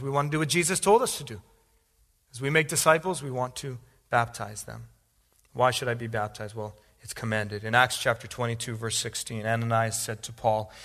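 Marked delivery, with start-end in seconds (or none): none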